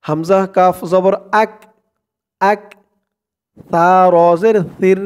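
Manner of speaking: normal talking voice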